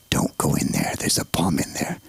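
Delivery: very low, croaked mock warning; grave, hammed-up low caution. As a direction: drop right down to warn him off. Comic warning after toilet disaster.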